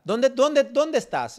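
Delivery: imitating an alarmed rescuer; urgent questioning. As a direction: fire off "dónde" repeatedly, high and pressing. Acts out Santiago's urgent phone reply.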